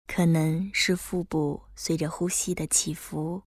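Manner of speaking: calm guide easing the body; guided meditation. Acted. Leads breath focus meditation.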